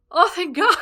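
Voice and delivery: overjoyed thank God; loud relief. Retold or imitated. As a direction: exhale the worry out. Relief retold, loud high.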